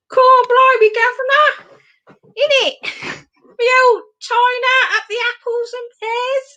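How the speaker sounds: high-pitched mock Cockney; hammed-up old London accent, high voice. Doing London for the Keeper of the Gates, mock Cockney accent. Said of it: "gonna do a London accent".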